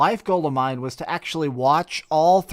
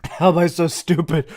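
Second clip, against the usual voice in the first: laughing to tears. Real, not acted. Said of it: "I'm crying".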